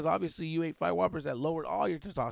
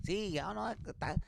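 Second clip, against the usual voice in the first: high, softer sympathetic paisa voice; paisa act; 6 semitones higher, 6 dB quieter than usual. Acted. Pulling the paisa on a talker, feigned sympathy. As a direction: play a paisa humoring someone, pitched up. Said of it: "should have pulled the paisa on him".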